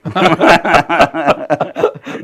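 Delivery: sustained chuckling laugh; real laugh. Real, not acted. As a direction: laugh heartily at the guest's remark. Interviewer laughs at boss anecdote. (నిజమైన నవ్వు)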